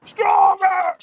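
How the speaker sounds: very high, loud drawn-out scream; screamed demand. Retold or imitated. Trainer screams at a holding-back firewalker, full retold scream. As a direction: scream it like a hype coach. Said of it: "He screams at me".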